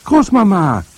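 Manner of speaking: put-on high, loud child's shout; boy yelling down, urgent; calling out. A narrator's reading. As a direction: cry out for grandmother. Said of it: "rief ich noch einmal".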